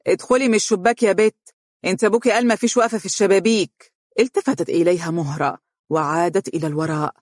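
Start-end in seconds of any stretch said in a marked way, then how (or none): 0.1-3.9 sneering, higher voice ordering her in; mocking stepmother. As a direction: taunt the girl from the doorway. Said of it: "تقول ساخرة".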